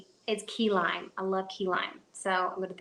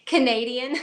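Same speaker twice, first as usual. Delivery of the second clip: amused surprise; 3 semitones higher, 6 dB louder than usual. Real, not acted. Echoing viewer's Canadian accent comment.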